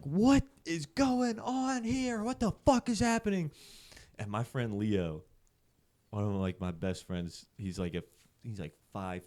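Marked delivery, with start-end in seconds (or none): 0.0-3.5 dad's furious yell. Retold or imitated. Furious dad to drunk kids at the party, high mock yell. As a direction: act out a furious parent's high-pitched yell.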